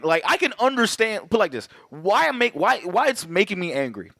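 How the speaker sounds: normal talking voice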